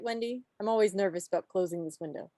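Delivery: ordinary talk, nothing marked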